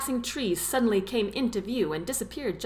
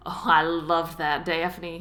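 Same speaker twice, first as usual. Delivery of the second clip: ordinary delivery, nothing marked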